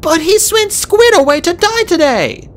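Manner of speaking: put-on high, desperate girl dragon; pleading. A narrator's reading. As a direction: beg urgently. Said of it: "Fate Speaker pleaded".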